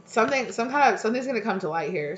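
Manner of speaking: ordinary delivery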